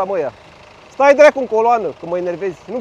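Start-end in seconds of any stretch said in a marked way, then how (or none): 0.9-1.9 loud, high snapped order; ordering the driver to stay in line, shouting; angry shout. Real, not acted. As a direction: bark it at her. Telling driver to queue.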